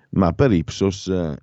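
normal talking voice

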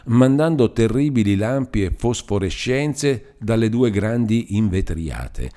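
ordinary delivery

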